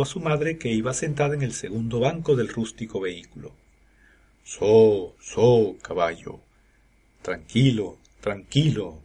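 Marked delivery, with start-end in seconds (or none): 4.4-9.0 calm a nervous horse; sweet soothing tone. A narrator's reading. Carter soothing his skittish horse, sweet calming tone. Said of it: "con su tono de voz más dulce".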